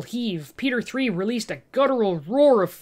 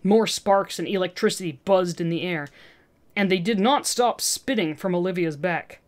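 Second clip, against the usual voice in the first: ordinary delivery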